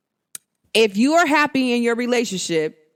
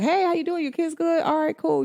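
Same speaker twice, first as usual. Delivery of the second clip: put-on light, higher, casual hello to an old friend; breezy friendly greeting; 7 semitones higher, 4 dB quieter than usual. To a former flame, keeping it casual, breezy. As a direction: toss off a friendly check-in.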